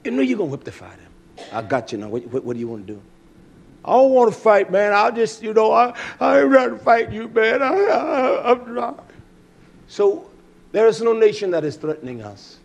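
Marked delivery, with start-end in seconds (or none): 1.5-3.0 low, soft confident taunt; a hint of a cool challenger. 3.8-9.1 a touch higher, nervous laughing denial; lightly voiced frightened bully; scared bully.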